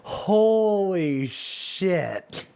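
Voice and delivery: slow, drawn-out astonishment; stunned, savoring each word slowly; amazed. Real, not acted. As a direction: stretch every word out in real disbelief. Astonished reaction to the phrase.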